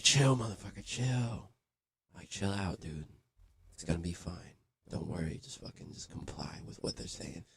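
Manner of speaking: hushed, telling a buddy to calm down; whispered plea to stay calm; whispering. Retold or imitated. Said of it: "I was whispering".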